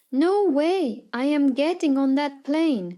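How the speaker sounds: loud, high defiant yell; a loud furious yell of defiance. Retold or imitated. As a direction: shout it, refusing to budge. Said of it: "I yell".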